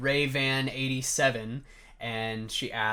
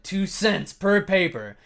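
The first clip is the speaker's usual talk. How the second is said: slow, higher and louder, word by word; indignant emphasis; 8 semitones higher, 6 dB louder, slower than usual. Real, not acted. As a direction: punch out each word slowly. Repeats the pay in mock outrage.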